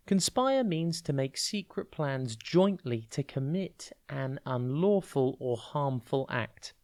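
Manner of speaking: ordinary talk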